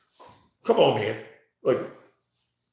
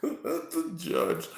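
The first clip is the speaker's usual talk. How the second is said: laughing; 8 semitones higher, much slower than usual. Real, not acted. Laughing slowly.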